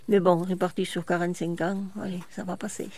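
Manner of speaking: ordinary talk, nothing marked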